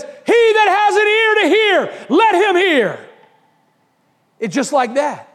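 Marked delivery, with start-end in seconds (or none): 0.0-3.1 a fuller, higher cry to the crowd. Retold or imitated. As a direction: lift the voice and call it out like Jesus. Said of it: "he cries out, and he says".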